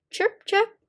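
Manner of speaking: high-pitched little chirps; bird. A narrator's reading. Teacher reading animal talk to children, as a bird. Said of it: "said the bird".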